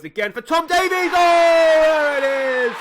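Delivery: big rising roar as the goal goes in; live goal call, shouting with joy; excited goal shout. Real, not acted. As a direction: yell it like the ball just hit the net. Goal scored, commentator erupts.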